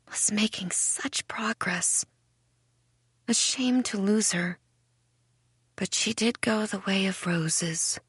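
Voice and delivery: sighing regret. A narrator's reading. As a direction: breathe it out with gentle regret. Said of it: "she sighs".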